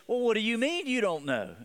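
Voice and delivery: incredulous, pitch jumping up; incredulous angel. Acted. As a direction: act out a baffled gatekeeper challenging the answer. Imagined angel challenges thief's answer.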